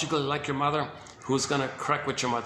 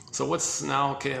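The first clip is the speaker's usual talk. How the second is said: usual speaking voice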